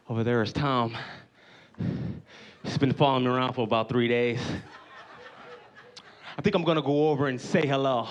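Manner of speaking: low, confiding voice to camera; imitated documentary host. Bear man tells camera about Tom, low host voice. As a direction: narrate low and earnestly to the viewers. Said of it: "looking in the camera, he said".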